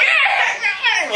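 high-pitched child's wail, hammed up; mock bloody-murder wail. Preacher mimics kids who cry like you're killing them, mock wail. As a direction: imitate an overdramatic screaming kid. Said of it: "Like you're killing them or something".

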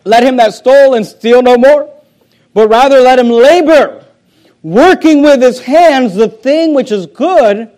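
loud preaching of the verse; forceful scripture reading. Acted.

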